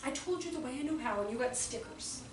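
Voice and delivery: soft, deflated imitated complaint; subdued child. Five-year-old telling adults he has tried, soft resigned complaint. Boy's thoughts, subdued take.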